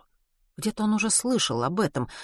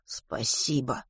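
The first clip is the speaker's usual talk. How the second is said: slow, soft, absent mutter; 4 semitones lower, 7 dB quieter, much slower than usual. A narrator's reading. Matvey thanking the driver as he walks off, muttering. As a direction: murmur it slowly, lost in thought. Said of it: "пробормотал Матвей".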